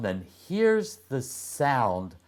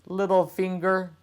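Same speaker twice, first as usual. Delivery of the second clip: put-on girl's voice; 10 semitones higher, faster than usual. The girl voiced in story.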